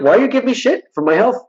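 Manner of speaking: high-pitched retold indignation; re-enacted indignant protest; indignant retort. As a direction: throw the question back, incredulous. Retold argument with his doctor.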